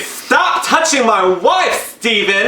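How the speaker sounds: high, drawn-out mock yell; indignant yell. Husband warning off the other man, indignant high yell. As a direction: stretch each word into a protest. Voices what husband should say.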